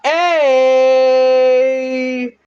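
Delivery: high, loud shout; hype shout. Acted. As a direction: yell it out to hype it up. Hype shout after the reveal.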